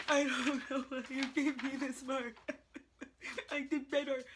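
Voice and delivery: mock tearful protest, laughing through it; mock whiny crying. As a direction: imitate yourself as a crying student, with laughs breaking through. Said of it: "being like".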